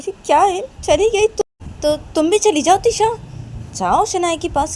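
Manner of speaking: high, loud goading; hammed-up playful pushing; teasing urging. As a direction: egg her on to follow, loudly. Family tells sister to follow.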